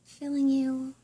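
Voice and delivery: soft, unhurried meditation voice with long pauses; guided meditation. Real, not acted. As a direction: gently guide listeners through breathing and visualizing.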